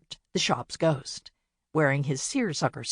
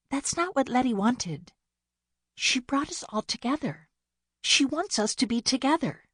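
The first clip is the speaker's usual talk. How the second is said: put-on lighter, higher young sister voice; Bea's voice; 8 semitones higher than usual. A narrator's reading. Youngest sister pleading with Winnie, higher young voice. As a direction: play the earnest youngest sister, pitched up. Bea voiced in cozy mystery.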